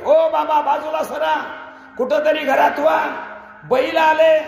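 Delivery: high urgent shouted warning; villagers shouting a warning. Retold or imitated. Villagers warning the old man about the bull, high shouting. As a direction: yell at the old man to get out of the way. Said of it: "लोक ओरडायला लागले".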